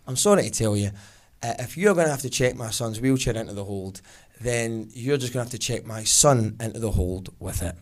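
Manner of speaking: low, composed, coolly polite mum; calm, passive-aggressive. Acted. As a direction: stay nice and cool while refusing. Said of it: "really calm".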